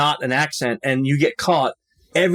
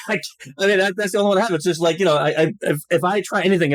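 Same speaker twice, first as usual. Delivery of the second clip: ordinary talk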